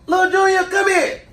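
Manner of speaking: put-on aunt summoning the boy to the door; aunt calling out. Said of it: "I hear her go".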